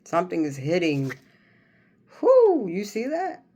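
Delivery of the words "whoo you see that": excited. Real, not acted. Excited at a card.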